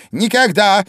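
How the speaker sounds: high, loud, angry cry; queen furiously denying it; angry exclamation. A narrator's reading. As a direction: spit it out in a rage. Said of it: "гневно воскликнула королева".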